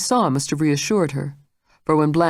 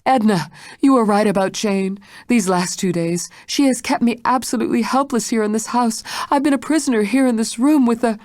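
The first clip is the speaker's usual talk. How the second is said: higher urgent pleading; desperate plea; 8 semitones higher, 3 dB louder than usual. A narrator's reading. Blanche pleads urgently while the maid is on the phone. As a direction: plead fast before she's cut off.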